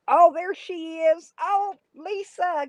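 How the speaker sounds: high, slow, drawn-out welcome; delighted greeting. Real, not acted. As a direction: stretch it out high, greeting someone dear. Spots Lisa arriving in the chat.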